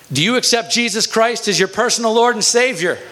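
high-pitched, acted evangelizing demand; urgent demand. Boy atop the bully demands conversion, acted urgent demand. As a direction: deliver the question high, as an ultimatum.

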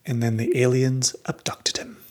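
conspiratorial whisper. Pretend. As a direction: whisper it like a secret. Teasing whispered joke.

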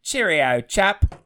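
a light British "cheerio, chap"; a hint of a British gent; answering the host's cue with a mild British goodbye; mock British accent. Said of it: "Say cheerio, toxic fans".

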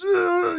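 loud, high gamer's cry; re-enacted panicked game yell; freaking-out yell. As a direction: yell out as if freaking out mid-match. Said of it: "you know, you're freaking out".

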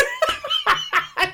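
high laugh. Real, not acted. Laughing about battle.

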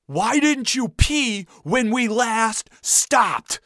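high, drawn-out exasperated yell; yelling dad. A narrator's reading. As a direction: stretch each word as a furious father. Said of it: "Dad yelled back".

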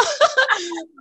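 high burst of laughter. Real, not acted. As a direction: laugh at your own outburst. Laughing at own outburst.

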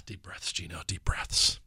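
exhale, then whisper it to yourself; hushed, slow talking yourself down; soft, breathed-out self-soothing; self-calming whisper. Real, not acted. Calming himself after getting worked up.

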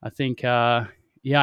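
ordinary delivery

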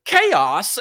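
"Chaos" punched high and loud; over-emphasized word. Retold or imitated. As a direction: mimic an anchor blaring "Chaos". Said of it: "I emphasize the word".

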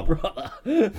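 Brother laughed out with a rising tune; laughing Brother. Real, not acted. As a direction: Brother through a laugh, going up. Reacting to Kate Beckinsale, laughing.

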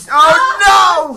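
sudden loud alarmed cry; alarmed shout. Real, not acted. Friend vomits again, shouting.

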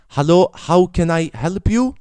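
mocking half-Russian accent on the phone. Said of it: "någon sån halvrysk fucking röst".

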